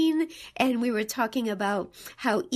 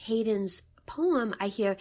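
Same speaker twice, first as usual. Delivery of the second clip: usual speaking voice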